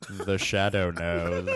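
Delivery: low, slow mock seductive line; sexy Orson Welles voice. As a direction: play a smoky radio villain. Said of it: "that's how I pick up guys at bars".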